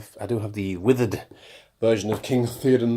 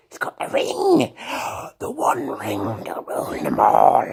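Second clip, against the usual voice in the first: Gollum craving the ring; slower than usual. Pretend.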